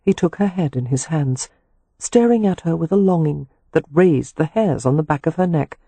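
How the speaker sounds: ordinary delivery, nothing marked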